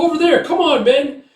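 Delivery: very high, loud call; rallying shout. Retold or imitated. Leader calls his men over, rallying shout. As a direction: urge the troops onward.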